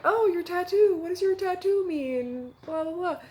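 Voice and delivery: high, eager quoted man's voice; mimicking the man. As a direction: pitch up to play the curious stranger. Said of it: "he was like".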